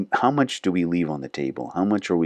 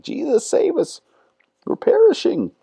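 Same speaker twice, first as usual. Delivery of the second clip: squeaky, much higher mock panicked cry; 6 dB louder than usual. Disciples freaking out in the storm, mock panicked squeal. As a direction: ham up the frightened disciples' plea. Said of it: "freaking out and like".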